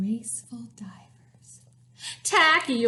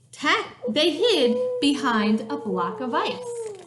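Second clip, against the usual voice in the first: plain reading voice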